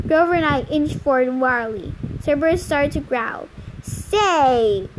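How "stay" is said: firm command to a dog; mildly commanding; ordering. A narrator's reading. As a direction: order a dog to hold still. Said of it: "Annabeth ordered the monster".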